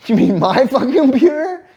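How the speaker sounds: high incredulous retort breaking into laughs; mock-furious, laughing disbelief; laughing outrage. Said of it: "I'm like".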